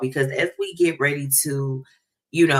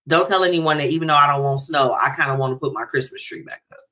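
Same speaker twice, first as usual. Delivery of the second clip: ordinary talk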